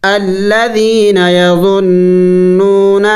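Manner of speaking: slow, drawn-out chanted Quranic recitation. Shown as a demonstration. Teacher recites the verse to the student in slow chanted recitation. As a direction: intone each word long and melodic.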